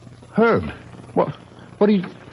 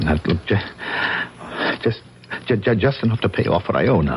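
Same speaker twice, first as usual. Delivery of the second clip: frightened stammer; 7 semitones lower than usual. Acted. Frightened man at gunpoint stammers.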